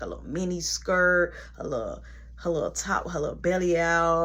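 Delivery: usual speaking voice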